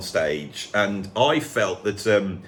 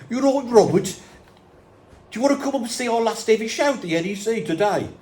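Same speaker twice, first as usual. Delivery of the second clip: a higher, mock Birmingham accent, fast; 10 semitones higher than usual. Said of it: "Tony goes".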